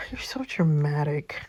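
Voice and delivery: sighed, breathless aside; exasperated whisper. Acted. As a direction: whisper it with a tired sigh.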